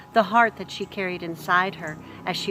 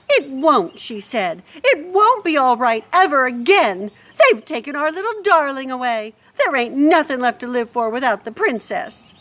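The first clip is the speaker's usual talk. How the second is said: loud, higher grieving wail; tearful cook; 6 semitones higher, 8 dB louder than usual. Acted. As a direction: sob out your despair. Crying cook.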